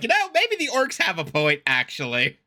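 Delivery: an elf conceding with mock sincerity; mock coping elf. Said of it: "You're like".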